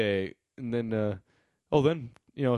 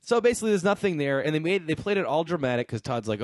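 ordinary delivery

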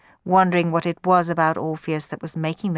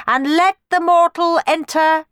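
higher, louder proclamation; raised ceremonial command; 10 semitones higher, 5 dB louder than usual. A narrator's reading. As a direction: Furies announce their verdict, slow at the end. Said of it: "they commanded".

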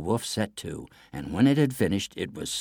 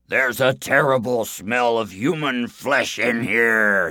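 loud, slow giant's bellow; 4 semitones higher, 6 dB louder, slower than usual. A narrator's reading. Giant smells a human in his castle, bellowing. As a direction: bellow it out, stretching the words. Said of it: "he bellowed".

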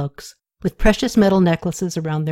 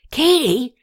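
calling her name again, a bit louder; a little louder; 6 semitones higher than usual. Acted. Rene calls Katie again across the temple room, a little louder. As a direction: raise the call just a notch. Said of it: "repeated her name a little louder".

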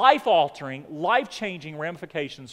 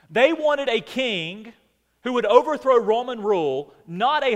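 preaching; 5 semitones higher, 5 dB louder than usual. Acted. Pastor pressing the point on Palm Sunday, emphatic. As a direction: drive it home to the congregation.

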